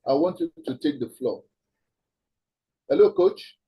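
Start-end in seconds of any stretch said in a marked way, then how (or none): 2.9-3.6 calling out to someone on the line; raised, higher call to check he's there. Real, not acted. Host to coach who hasn't answered, calling out.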